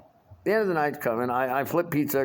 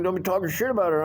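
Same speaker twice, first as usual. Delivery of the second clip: fast, higher put-on whiny voice; mocking busboy voice; 7 semitones higher, much faster than usual. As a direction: rattle it off as if he were nagging. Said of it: "and he's like".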